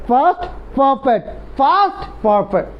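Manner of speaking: past perfect said very slowly, every sound clear; careful pronunciation. Shown as a demonstration. Teacher modelling pronunciation, slow and precise. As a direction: drag out past perfect so they copy it. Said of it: "উচ্চারণ ঠিক করবেন সবাই".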